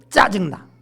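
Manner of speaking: loud irritated snap; annoyed outburst. Retold or imitated. Family member at home complaining, loud and irritated. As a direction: blurt out your annoyance loudly.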